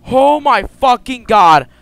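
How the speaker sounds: loud, slow, stunned swearing; shocked loud exclaim. Real, not acted. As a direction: blurt it out in shock. Reacting to jump scare.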